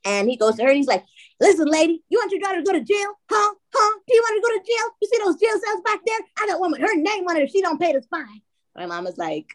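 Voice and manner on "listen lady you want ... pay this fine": high-pitched, hectoring mock cop; threatening officer. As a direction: imitate an officer badgering her, voice pitched way up. Officer threatening mom with jail.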